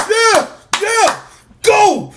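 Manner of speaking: high-pitched mock cheer; imitated fan cheering. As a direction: cheer like a hungry hometown fan. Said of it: "they cheer".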